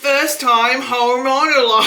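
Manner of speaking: loud, slow, laughing mock Aussie; badly faked Australian, shouted through giggles; attempted Australian accent. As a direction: try an Australian accent and crack up. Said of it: "I can't say it".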